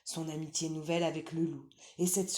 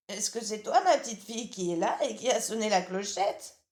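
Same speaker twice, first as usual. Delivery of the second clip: lightly higher, louder granny voice; old grandmother; 5 semitones higher, 7 dB louder than usual. Acted. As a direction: call out as a drowsy old granny from bed. Grandmother answers the door.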